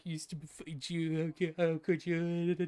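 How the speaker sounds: words dissolving into laughter and babble; slurred, giggly mock scolding; retold laughing fit. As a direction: imitate a friend insulting through helpless laughter. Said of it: "And you just started laughing".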